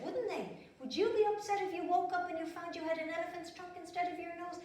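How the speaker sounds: ordinary delivery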